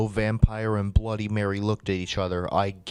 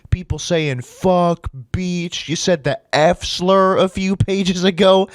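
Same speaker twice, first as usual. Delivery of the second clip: loud, raised-pitch disbelief; incredulous rant; 5 semitones higher, 5 dB louder than usual. Real, not acted. Reader ranting at the story's sudden censoring, incredulous. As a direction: rant as if you cannot believe what you read.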